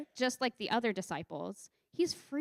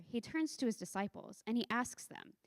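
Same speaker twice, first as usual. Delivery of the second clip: usual speaking voice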